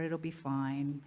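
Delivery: slow, low, settling-for-it reassurance; complacent shrug. Acted. Speaker voicing those settling for another year, low complacency. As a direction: let it drop, low and slow, as if resigned.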